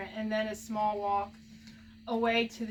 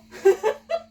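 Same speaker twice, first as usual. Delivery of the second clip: teary laugh; 10 semitones higher, 8 dB louder than usual. Real, not acted. Overjoyed by the card.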